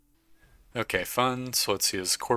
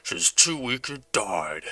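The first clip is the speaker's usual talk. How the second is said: slow, put-on villain voice; mad janitor; 3 semitones higher, 4 dB louder, slower than usual. Mad janitor over his captive girl, slow menacing character voice. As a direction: play a deranged janitor, drawing the words out. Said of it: "you hear the mad janitor say".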